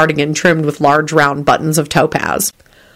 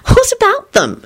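very high, teasing question; coy. A narrator's reading. Solange questioning a blurted remark, high and coy. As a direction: lift it right up, playfully coy. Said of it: "she said coyly".

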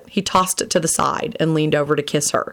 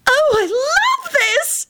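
very high squeal with "love" drawn out; delighted yelp; much slower than usual. A narrator's reading. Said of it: "she yelped".

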